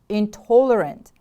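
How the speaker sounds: "Intolerant" with a strong, stressed TOL; stress on second syllable. Shown as a demonstration. Teacher models the word for repeating, stress on TOL in "Intolerant". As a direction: punch the second syllable of "Intolerant", then fall. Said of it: "The stress is on the second syllable".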